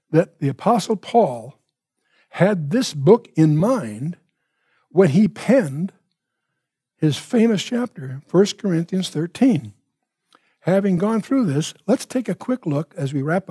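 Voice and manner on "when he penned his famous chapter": ordinary delivery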